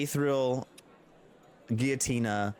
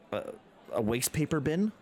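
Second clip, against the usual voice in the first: ordinary talk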